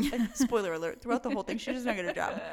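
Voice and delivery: loud laughing spoiler; laughing reveal. Real, not acted. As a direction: spill the spoiler while laughing. Laughing at the book's twist.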